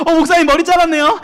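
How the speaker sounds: high, bright rising "잘랐네요"; 학생이 목사님 머리를 알아보며 높게 올려 말하는 인사; mock student greeting. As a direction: imitate a student noticing the haircut. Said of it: "한 열 명한테 그랬어요".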